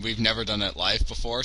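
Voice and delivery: ordinary talk, nothing marked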